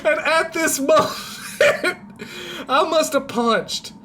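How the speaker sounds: high, helpless laughter through the words; cracking up mid-story; losing it laughing. Real, not acted. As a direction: fight through real giggles to keep telling it. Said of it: "I'm losing it".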